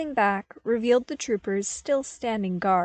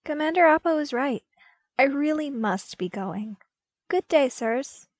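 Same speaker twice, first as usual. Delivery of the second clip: polite exit; 6 semitones higher, 4 dB louder than usual. A narrator's reading. Luke excusing himself to senators.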